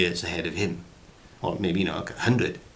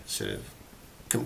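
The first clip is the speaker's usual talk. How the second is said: ordinary talk, nothing marked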